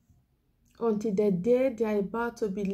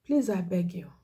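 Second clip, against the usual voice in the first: ordinary talk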